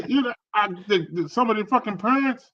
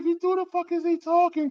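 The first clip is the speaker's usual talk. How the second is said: shocked; 12 semitones higher than usual. Retold or imitated. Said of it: "I was like".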